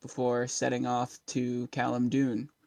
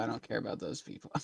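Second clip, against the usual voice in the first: mock dismissive aside; 10 dB quieter, much faster than usual.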